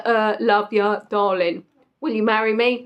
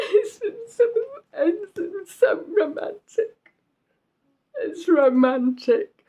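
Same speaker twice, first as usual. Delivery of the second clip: mock tearful swoon; 7 semitones higher, slower than usual. Gushing over film line.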